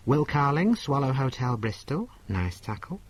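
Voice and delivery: ordinary talk, nothing marked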